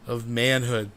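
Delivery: slowed-down, loud and booming; slow dramatic announcer. Pretend. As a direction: drag every word out like slow motion. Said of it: "Let's go slower".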